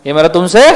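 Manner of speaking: rising, left open on "तुमसे" for the class to finish; fill-in prompt. Real, not acted. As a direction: cue the class, lifting "तुमसे" like a question. Prompting class to finish his sentence. ("तुमसे" पर आवाज़ उठाकर अधूरा छोड़ें)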